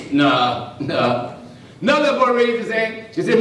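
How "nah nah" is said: low, unimpressed refusal; dismissive boys. Retold or imitated. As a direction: drop the pitch and dismiss it. Boys reject second lie.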